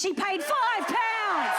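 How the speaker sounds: high, slow shout of mock disbelief; mock outrage at a bargain; shocked shout. Real, not acted. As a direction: yell it out in amazement. Comedian shocked at price.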